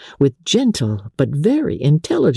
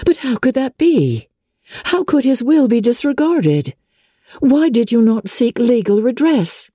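put-on higher young woman's voice, shocked and indignant; Elizabeth, indignant; 11 semitones higher than usual. A narrator's reading. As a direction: rise up in disbelief at the injustice.

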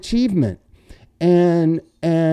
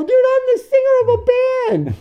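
indignant protest; 4 dB louder, much faster than usual. Retold or imitated.

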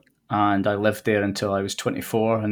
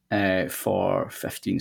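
ordinary talk

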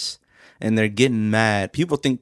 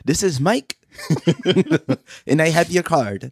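mock call-centre agent; 6 semitones higher than usual. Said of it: "You sound like you work for A T and T".

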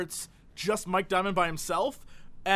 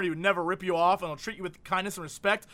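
usual speaking voice

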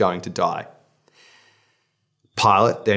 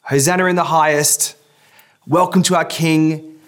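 crowd hailing; 7 semitones higher, 4 dB louder than usual. Retold or imitated. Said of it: "the crowd shouting".

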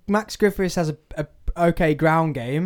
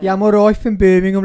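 louder, hammed-up Birmingham accent that slides toward Liverpool; 4 semitones higher, 7 dB louder than usual. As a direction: show off a Brummie accent. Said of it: "you sound like you're from Liverpool now".

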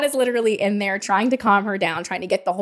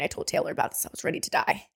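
whispered aside; 5 semitones lower, 9 dB quieter, faster than usual. Real, not acted. Whispered confession of exhaustion.

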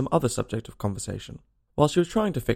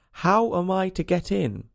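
louder, slower, higher repeated question; 7 semitones higher, slower than usual. A narrator's reading. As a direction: ask it again, louder and slower. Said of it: "asked Alice again in a louder tone".

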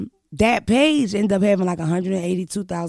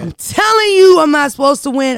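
higher, much louder victory yell; triumphant shout; 7 semitones higher, 10 dB louder than usual. Real, not acted. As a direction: shout it like you just won. Hyping her underdog success.

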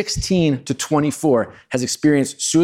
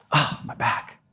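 hushed, strained mock groan of pain; quiet imitated moan after a fall; 6 dB quieter, slower than usual.

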